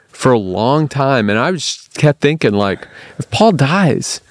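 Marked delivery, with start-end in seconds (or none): 3.2-4.2 high, worried exclamation; mock-desperate, pitched up; retold panic. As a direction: replay your panicked thought, half amused. Retells his panicked thoughts when stranded.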